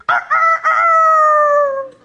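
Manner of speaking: high, loud mock rooster crowing. Hecklers crowing at the preaching Peter, mock rooster. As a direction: crow like a heckler in the crowd.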